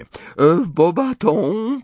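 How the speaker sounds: high, slow condescending drawl; gracious superiority, talking down to the others; Fleur. A narrator's reading. Fleur compares schools with Hogwarts, in lofty condescension. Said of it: "powiedziała Fleur tonem łaskawej wyższości".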